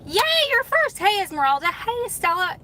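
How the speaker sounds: high, loud happy squeal; excited squeal. Real, not acted. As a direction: squeal with delight at the first viewer. Greeting first viewer joining.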